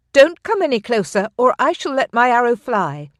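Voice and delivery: high, loud threat at bowpoint; fierce warning. A narrator's reading. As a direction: warn him off, ready to shoot. Aaliyah warns him at bowpoint.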